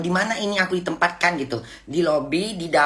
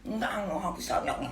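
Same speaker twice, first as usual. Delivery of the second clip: put-on soft, softer child's whine; whining child; 4 semitones higher, 9 dB quieter than usual. Mother showing her childish whine to viewers. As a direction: whimper it like a little kid. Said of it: "kayak anak kecil merengek".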